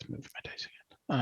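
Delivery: soft whisper to self. Real, not acted. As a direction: whisper it to yourself. Moving dice quietly.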